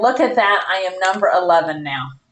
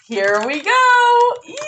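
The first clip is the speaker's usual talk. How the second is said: excited; 12 semitones higher than usual. Real, not acted. Bracing for the big sale.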